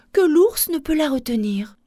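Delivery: usual speaking voice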